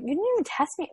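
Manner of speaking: high, incredulous protest; indignant disbelief. Retold or imitated. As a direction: push back in disbelief. Indignant retort to doctor.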